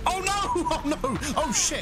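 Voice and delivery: high-pitched panicked cries; panicked yelp. Real, not acted. Gamer yelping as the trampler attacks. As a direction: yelp in real alarm.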